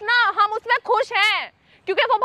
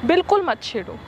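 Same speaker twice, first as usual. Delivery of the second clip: low, calm threat said "lovingly"; lowered warning; 6 semitones lower than usual. Real, not acted. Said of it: "मैं प्यार से बोल रही हूँ".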